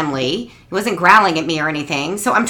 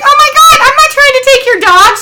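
very high, loud panicked protest; horrified denial; 9 dB louder than usual. Retold or imitated. Mother protesting to the dog's owner, very high and panicked. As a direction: deny it in a panic. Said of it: "I'm like".

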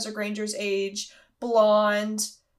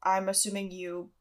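ordinary talk, nothing marked

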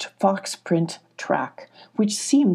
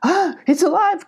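high, panicky goat exclamation; gasp, then cry out high and nervous; 11 semitones higher, 4 dB louder than usual. A narrator's reading. Goat seeing the stranger is alive, nervous cry. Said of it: "cried the goat nervously".

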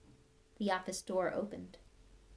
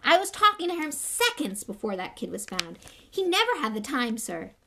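loud, urgent, higher plea; urgently; 4 semitones higher, 7 dB louder than usual. A narrator's reading. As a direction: burst in pleading, much louder. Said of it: "said Hagrid urgently".

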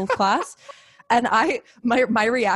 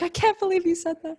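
higher, put-on scandalized voice; mock outraged commenter; 9 semitones higher than usual. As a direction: parody a shocked viewer. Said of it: "comments like".

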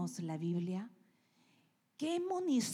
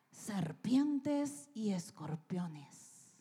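slow, hushed repeat of serpientes y escorpiones; whispered echo; much slower than usual. Acted. Preacher to the congregation, echoing God's words in a slow whisper.

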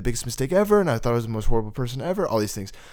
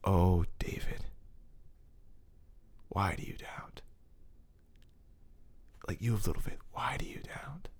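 tender whisper; 4 semitones lower, 13 dB quieter than usual. Retold or imitated. God whispering his name to him on the floor, soft whisper. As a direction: whisper it close, gently. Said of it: "he goes like this".

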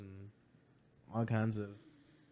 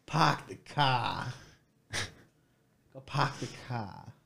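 put-on Boston accent, no r in "Park" or "car", slow; 3 dB quieter, slower than usual. Talking favorite accents, a slow Boston accent. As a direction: drop the r's Boston style, pahk the cah. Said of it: "if you wanna talk accents, my favorite's Boston".

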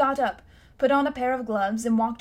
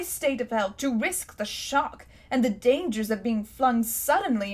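put-on blustering, higher, louder old gentleman; pompous old uncle; 4 semitones higher, 4 dB louder than usual. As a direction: puff up like an affronted scholar. Uncle voiced in the story.

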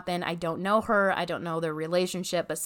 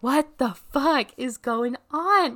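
higher, louder, slowed-down disbelief; alarmed disbelief; 6 semitones higher, 5 dB louder, slower than usual. Retold or imitated. Watching the ex shine a flashlight into a car, stunned and loud. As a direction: stretch it out in shocked confusion. Sees ex searching friend's car.

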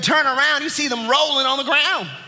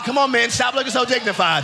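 ordinary delivery

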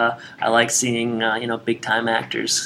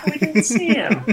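higher, words through real laughter; laughing; 13 semitones higher than usual. Real, not acted. Co-host amused by the hamster pick, laughing. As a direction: crack up while saying it. Cracks up over hamster as guest star.

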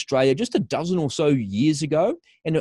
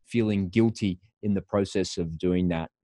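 usual speaking voice

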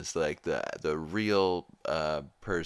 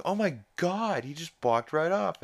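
imitating Lois; 11 semitones higher than usual. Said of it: "Lois is like".

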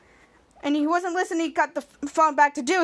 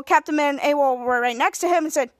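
ordinary delivery

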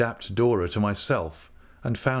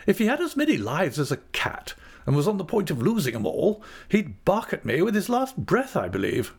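high, animated character voice; exclaiming aunt; 9 semitones higher than usual. A narrator's reading. Aunt on the dog barking at her, exclaiming. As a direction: exclaim in a high voice, settling at the end.

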